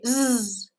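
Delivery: "Z" as a long voiced buzz; buzzy Z sound. Shown as a demonstration. Teacher has learners feel their throat, "Z" buzzed long. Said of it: "the Z is buzzy".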